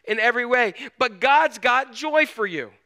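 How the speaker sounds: loud, high-pitched preaching push; forceful preaching. Acted. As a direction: preach it out to the room with full force.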